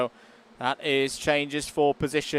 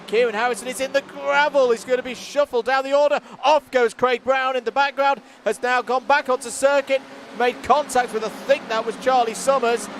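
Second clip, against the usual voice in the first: rising race-caller excitement at the chaos; excited commentary; 8 semitones higher, 3 dB louder than usual. Real, not acted. Commentator calls the lap one crashes, excited and loud. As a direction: voice jumps high and loud as cars spin off.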